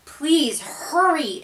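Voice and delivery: slow, high pleading; begging. A narrator's reading. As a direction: beg them to hurry. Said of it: "Imogene begged".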